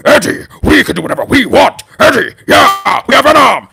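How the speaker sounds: loud, growling monster voice; hammed-up symbiote roar, words stretched out; Venom impression. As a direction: roar it like a split-personality monster. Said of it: "I did the Venom".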